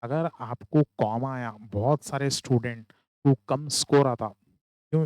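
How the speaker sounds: ordinary talk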